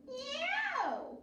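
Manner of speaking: high call to the cow. A narrator's reading. Witch calling out high to the cow. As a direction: sing out a high yoo.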